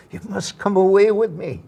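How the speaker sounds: retold lover's urging; juvenile romantic lead. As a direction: plead like a romantic lead, lightly hammed. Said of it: "I was the juvenile romantic lead".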